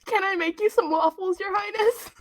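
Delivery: high, squeaky fawning servant voice; mock servant. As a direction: simper like a nervous servant to royalty. Joking servant offer to queen.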